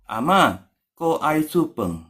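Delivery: "Ama ko ai cu peng" slow, "ama" falling; slow intonation model. Shown as a demonstration. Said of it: "intonasinya kau manfaatin". ("Ama ko ai cu peng" pelan, "ama" turun)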